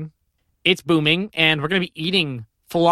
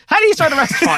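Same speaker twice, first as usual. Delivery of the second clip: mock high voice; 12 semitones higher, 5 dB louder, faster than usual.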